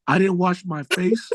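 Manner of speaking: ordinary talk, nothing marked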